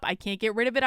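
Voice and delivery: ordinary delivery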